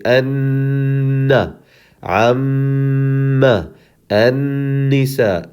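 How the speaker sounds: long hummed n and m in anna, amma, annisa; long held nasal. Shown as a demonstration. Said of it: "you hold them longer than any other letter".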